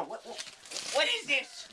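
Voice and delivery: high-pitched exclaimed question; incredulous outcry. Real, not acted. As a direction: demand it in high disbelief. Exclaiming at what he sees.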